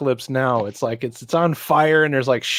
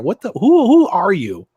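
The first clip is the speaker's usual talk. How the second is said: mock disbelief; 6 semitones higher, 4 dB louder than usual. Real, not acted.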